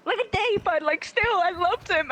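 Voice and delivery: high, whiny mock voice; mock naive girl. Mocking a naive girlfriend, high whiny defense. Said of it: "How long did you know him".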